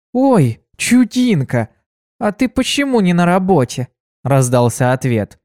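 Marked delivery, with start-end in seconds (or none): 0.1-3.9 lightly voiced friendly mare, higher; sweet surprised greeting; cheerful mare voice. Kuna greets her friend Ditsy, high and sweet.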